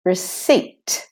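receipt said as "re-SEET", no P sound; silent P. Shown as a demonstration. Teacher models receipt for learners, silent P. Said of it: "there is no P in receipt".

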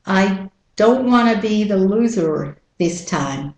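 American R at the end of "loser"; American-accent model sentence. Shown as a demonstration. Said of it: "And with the American accent".

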